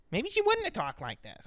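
high, prissy mimicking voice; mock her in a high put-on voice; mock woman's voice. Host mocking how a woman would have talked, high and prissy.

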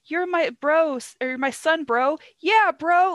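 high, loud, hammed-up enthusiasm; mock bro bonding. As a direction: hype up your bro, playfully overdone. Voices the sunflower meme.

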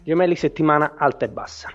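bright radio announcer read; horoscope reading. Acted. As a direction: announce the star signs like a DJ. Reads the horoscope. (lettura dell'oroscopo da speaker radiofonico)

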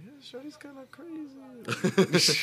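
high mock voice. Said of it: "I was like".

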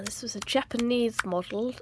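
ordinary talk

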